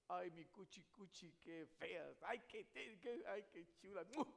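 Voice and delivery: loud, high-pitched lovey-dovey cooing; hammed-up pet-name endearments; mock sweet talk. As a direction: gush over a sweetheart in jest. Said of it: "Cuando usted se enoja, no dice".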